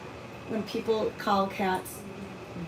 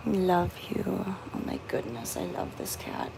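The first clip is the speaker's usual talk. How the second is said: whispering; 6 dB quieter than usual. Real, not acted. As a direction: whisper your love to the cat. Whispering affection to cat.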